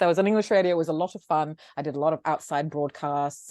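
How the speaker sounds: normal talking voice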